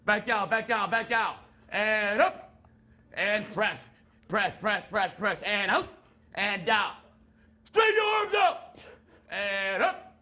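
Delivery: high barked drill commands; barking gym coach. Pretend. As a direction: drive the recruit through reps. Drill sergeant leads exercise.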